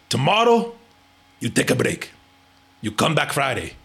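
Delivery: lightly imitated Turkish accent, halting phrases; broken Turkish English. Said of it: "he speaks broken English".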